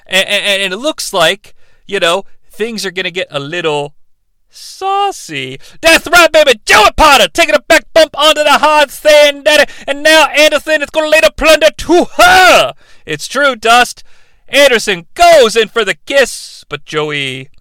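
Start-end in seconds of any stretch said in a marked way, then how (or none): 4.5-12.7 loud, higher wrestling-promo voice, shouting; Dusty Rhodes impression. Co-host as Dusty Rhodes cutting a promo on the recap, loud and high. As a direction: hype it like an old wrestling legend cutting a promo. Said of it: "[name]'s Dusty Rhodes".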